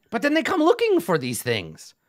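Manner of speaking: high, eager goblin voice; goblin Yek. Acted. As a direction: squeak it out like a greedy goblin.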